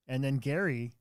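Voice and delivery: normal talking voice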